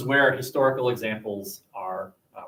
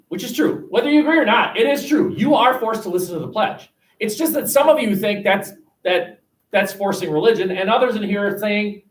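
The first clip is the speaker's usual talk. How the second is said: emphatic; 7 semitones higher, 4 dB louder than usual. Real, not acted. Teacher insisting to class.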